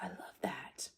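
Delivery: hushed, whispered admiration; awed whisper. Retold or imitated. Coach recalling a reaction to a film, awed whisper.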